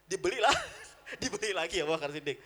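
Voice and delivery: words broken by real laughter; amused laughing. Real, not acted. Preacher recounts Abu Bakar buying him back, laughing. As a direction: tell it while chuckling.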